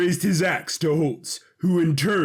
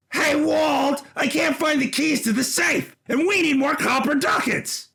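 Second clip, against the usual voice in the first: much higher, frantic call across the room; high excited yell; 10 semitones higher than usual. Acted. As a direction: yell for Walt over the din. Brother yelling across the pub.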